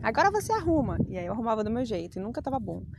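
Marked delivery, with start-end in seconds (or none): 0.0-1.0 lightly voiced bossy mother, pitched up; a hint of mom giving orders; higher mock-motherly command; imitating her mother. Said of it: "minha mãe jogava as coisas no chão".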